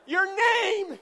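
imagined exasperated retort. Retold or imitated. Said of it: "wanted to turn around and say".

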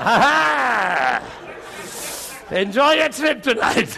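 laughing taunt; mocking laughter. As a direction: jeer at him through a laugh. Assistant laughing at him in trouble.

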